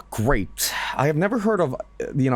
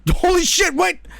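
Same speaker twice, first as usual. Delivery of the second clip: startled; 5 dB louder than usual. Real, not acted. Startled by footage.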